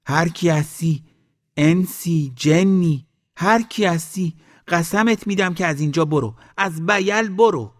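high-pitched, insistent calling; loud shouting. A narrator's reading. Old woman orders spirits out of the valley, shouting loudly. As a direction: shout it down like an exorcism. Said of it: "بلند داد زد".

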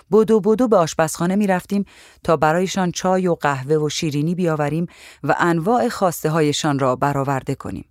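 plain reading voice

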